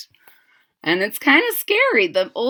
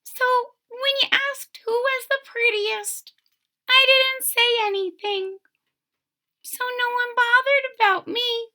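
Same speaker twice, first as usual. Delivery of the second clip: very high, small kitten voice; tiny kitten voice; 13 semitones higher than usual. Acted. Kitten explains to the old couple, very high and small. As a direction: squeak it out like a shy little cat. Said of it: "said the kitten".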